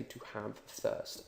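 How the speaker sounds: "to have thirst" slow and near whispered; right literal phrase. Shown as a demonstration. Teacher gives the right phrasing, "to have thirst" slow and hushed. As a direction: softly model "to have thirst". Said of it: "you say".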